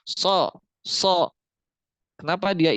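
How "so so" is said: "So" with a full rounded o; heavy so. Shown as a demonstration. Teacher demonstrating the isti'la sound to class. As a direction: raise the tongue root and say "So" thick. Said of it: "Karena ada bunyi o".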